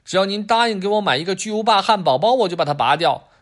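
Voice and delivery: name your price like a little dealer; bargaining kid. Acted. Adam bargaining for a skateboard, cheeky child voice. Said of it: "亚当说".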